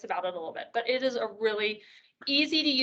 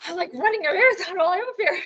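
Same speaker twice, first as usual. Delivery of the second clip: breathless laughing joke; 7 semitones higher, 3 dB louder, faster than usual. Real, not acted. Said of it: "I'm out of breath today".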